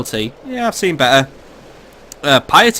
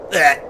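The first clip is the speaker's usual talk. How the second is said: mock death gurgle; 3 semitones higher than usual. The Pope dies dramatically.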